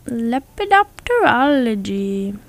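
LEPIDOPTEROLOGY stretched slowly with swooping pitch; slow, sing-song lepidopterology; playfully drawn out. Pretend. Word repeated playfully, drawn out.

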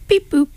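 high, playful beep-boop noise; imitated beep boop. Imitating co-host's habit for listeners, high beep boop. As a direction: mimic a little vocal sound effect. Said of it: "sometimes he just goes".

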